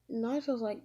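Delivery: ordinary delivery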